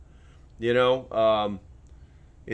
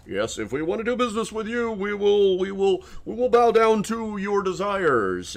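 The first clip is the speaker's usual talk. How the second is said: grovel like a servant; servile yes-master voice; 8 semitones higher than usual. Retold or imitated. Credit companies bowing to government, high, groveling. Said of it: "credit companies are gonna be like".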